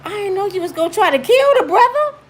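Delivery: high, loud stunned exclamation; astonished high cry; shocked. Retold or imitated. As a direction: react amazed at the beating. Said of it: "I was like".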